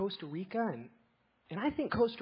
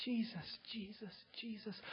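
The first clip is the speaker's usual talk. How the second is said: softly whispered Jesus, Jesus; hushed reverent whisper; 16 dB quieter than usual. Real, not acted. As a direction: breathe the name in reverence. Reverent repetition of name.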